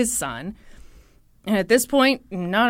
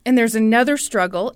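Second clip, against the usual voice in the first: normal talking voice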